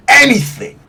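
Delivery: ANYTHING pitched high and punched; emphatic repeat. Acted. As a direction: throw out ANYTHING as a thrilling promise. Game master urging the player on.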